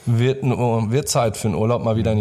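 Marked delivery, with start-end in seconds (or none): none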